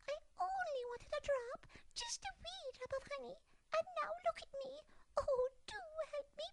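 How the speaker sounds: very high, fast mouse squeak; a stuck mouse pleading; desperate squeak. Acted. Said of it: "she squeaked in desperation".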